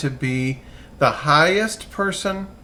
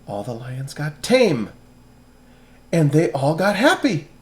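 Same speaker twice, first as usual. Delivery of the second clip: plain reading voice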